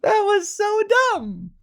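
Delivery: high, loud laughing squeal. Real, not acted. Podcaster cracking up at own silly rhyme, laughing.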